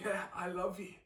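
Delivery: softly voiced Peter owning up; hushed ashamed reply. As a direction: confess it quietly, head bowed.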